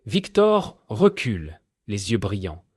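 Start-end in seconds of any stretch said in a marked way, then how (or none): none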